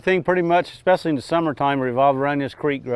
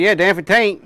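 Ocracoke brogue; 6 semitones higher, 6 dB louder than usual. Retold or imitated. Retold joke punchline.

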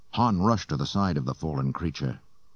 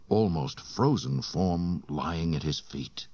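ordinary delivery

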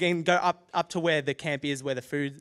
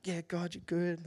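soft, higher half-hearted praying; frustrated; 4 semitones higher, 7 dB quieter than usual. Retold or imitated. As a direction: go through the motions of praise. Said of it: "I'm a bit frustrated".

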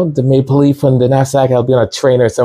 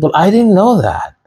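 a lightly voiced, higher, surprised younger self; surprised naive reply; 10 semitones higher than usual. Caught out by the teacher, a mild mock surprised reply. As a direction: play innocent. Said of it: "I said".

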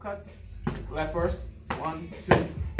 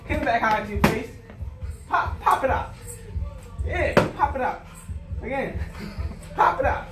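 loud, high, pumped-up pad calls; hype coaching; 8 semitones higher, 4 dB louder than usual. Real, not acted. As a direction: fire up the fighter with every call. Coach hyping up pad work.

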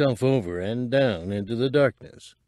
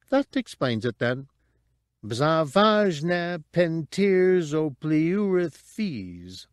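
lightly voiced prim droid; prissy protocol robot; fussy droid voice; 6 semitones higher, slower than usual. Droid speaks alien tongue.

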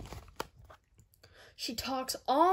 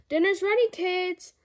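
higher, louder call across the house; 5 semitones higher, 5 dB louder than usual. Acted. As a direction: call the kids to dinner. Mom calls to dinner.

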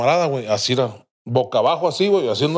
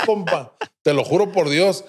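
ordinary delivery

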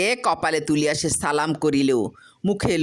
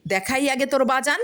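sneering retort; 10 semitones higher than usual. Acted. Wife sneers.

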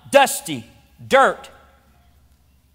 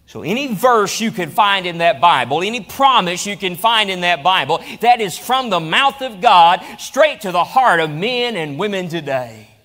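ordinary talk

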